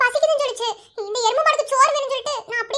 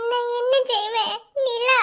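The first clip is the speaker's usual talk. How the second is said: tearful, helpless wail; crying; 2 semitones lower, slower than usual. Acted. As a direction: cry the words out between sobs. Woman sobbing alone in distress.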